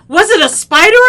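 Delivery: very loud, high question; acted shouted question; alarmed brother. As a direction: yell it as the panicking brother.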